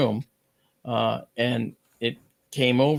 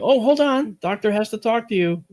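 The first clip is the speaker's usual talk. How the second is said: high-pitched receptionist cutting in; imitated receptionist; 9 semitones higher, 4 dB louder than usual. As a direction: put on a much higher voice to interrupt a caller. Receptionist cuts in on phone.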